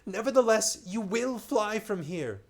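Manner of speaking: high, urgent gasped plea; a frightened stranger gasping a warning. Acted. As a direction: gasp it out, high and pressing. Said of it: "he gasped".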